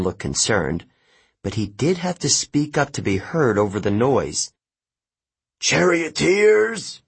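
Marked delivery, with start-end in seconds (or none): 5.6-7.0 high, drawn-out call to the racers; announcer's shout. A narrator's reading. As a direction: shout it slowly over a crowd's noise. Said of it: "he shouted".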